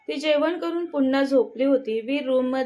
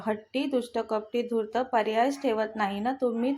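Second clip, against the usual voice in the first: ordinary delivery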